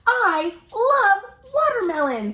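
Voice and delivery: high, slow hungry croc; crocodile voice. Acted. Crocodile gushing to readers about watermelon, high and delighted. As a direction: savor every word as the croc. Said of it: "says the croc".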